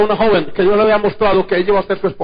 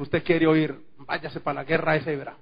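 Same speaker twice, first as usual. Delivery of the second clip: quiet, dry sarcasm, much softer; sarcastic retort; 5 semitones lower, 11 dB quieter than usual. Retold or imitated. Prophet answering King Ahab, softly sarcastic. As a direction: let the irony drip, hushed. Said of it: "se lo dijo en un tono sarcástico".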